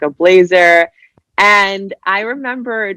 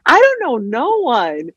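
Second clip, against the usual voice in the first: mock clueless self; 9 semitones higher than usual. Young job seeker admitting no connections, high whiny voice. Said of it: "I was like".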